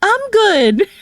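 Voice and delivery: a snooty "no thanks" in a squeaky voice; dismissive quote. Retold or imitated. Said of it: "they're like".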